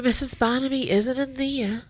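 usual speaking voice

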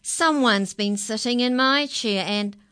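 Daddy Bear's complaint. A narrator's reading. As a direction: boom the grievance out as Daddy Bear. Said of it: "Daddy Bear said".

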